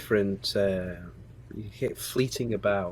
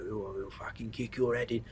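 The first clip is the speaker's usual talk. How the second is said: mock Cockney thug; 8 dB quieter than usual. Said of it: "to fight the Cockney slang".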